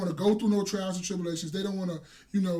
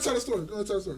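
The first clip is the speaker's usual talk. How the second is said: normal talking voice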